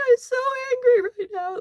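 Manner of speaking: acted high-pitched tearful rage; crying anger. Grieving friend falls apart before her friends, acted crying anger. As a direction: mimic someone wailing with anger. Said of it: "she's crying so hard".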